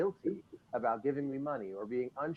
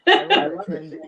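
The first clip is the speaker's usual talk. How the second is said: much higher and louder, laughing; excited; 18 dB louder than usual. Real, not acted. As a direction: burst out with delight. Delighted reaction to guest.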